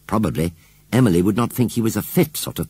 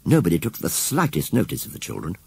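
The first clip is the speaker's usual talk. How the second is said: usual speaking voice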